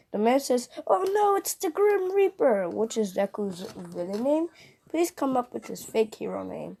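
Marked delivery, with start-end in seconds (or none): 0.9-2.7 mock fright. Frightened man sees the villain.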